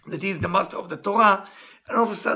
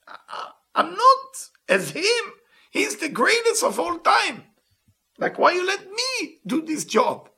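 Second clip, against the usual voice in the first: humble Moses pleading; 11 semitones higher than usual. Acted. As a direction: plead as if you were a nobody. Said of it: "is talking as if he's a child, as if he's a nobody".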